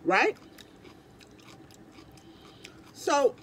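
talking with food in mouth; speaking between bites; chewing. Real, not acted. Seen 2: forkful taken at -2.0; jaw working, lips pursed while talking.

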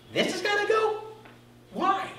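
high, thin mock-indignant whine; whiny protest. Preacher mocking his own whiny protest to the Spirit. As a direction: play yourself pleading like a kid. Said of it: "his own whiny reply".

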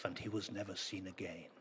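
usual speaking voice

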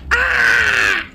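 sharp high yelp of fright; sudden high scream; startled scream. Real, not acted. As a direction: jump and shriek at the shock.

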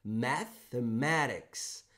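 "mathematics" said very slowly with a clear TH; slow careful demo. Shown as a demonstration. Teacher slowly models the TH in a long word. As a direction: stretch "mathematics" out syllable by syllable. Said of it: "O mesmo acontece com math".

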